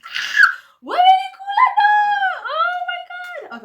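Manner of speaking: high, loud shrieking outcry; hammed-up loud wail; mock shriek. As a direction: scream it as a mock panic. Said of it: "I was like".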